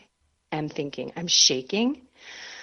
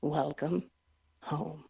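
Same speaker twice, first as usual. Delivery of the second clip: much softer and lower, tender and slow; beautiful gentle voice; 4 semitones lower, 12 dB quieter, slower than usual. Retold or imitated. Priest greeting a scared penitent, tender and much softer. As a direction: welcome her home like a kind father. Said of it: "in the most beautiful, beautiful voice".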